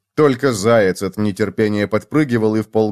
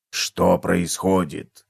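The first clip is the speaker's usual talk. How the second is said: low, very slow puzzled voice; bewildered cockroach; 6 semitones lower, 4 dB quieter, much slower than usual. A narrator's reading. Cockroach asking what is happening, slow and bewildered. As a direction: ask it slowly and low, baffled. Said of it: "недоумённо спросил таракан".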